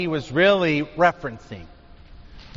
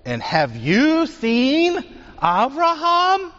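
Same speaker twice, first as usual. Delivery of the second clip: higher, rising in disbelief; incredulous challenge; 10 semitones higher, slower than usual. Acted. Crowd challenging Yeshua, higher and incredulous. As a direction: scoff that he could have seen Abraham.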